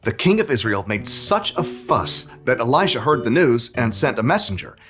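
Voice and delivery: ordinary delivery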